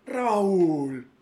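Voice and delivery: tired dad. Acted. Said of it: "papá cansado".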